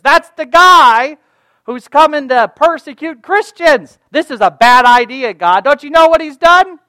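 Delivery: high, loud, incredulous protest; alarmed Ananias. Acted. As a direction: blurt out a panicked objection to God. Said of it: "He says".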